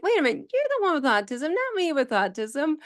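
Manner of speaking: high, rapid mock indignation; joking protest. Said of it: "I say that jokingly".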